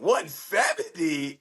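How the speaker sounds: slow, high incredulous repeat; stunned disbelief. Retold or imitated. Fighter told his weight class, repeating it in shock. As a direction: drag it out in disbelief.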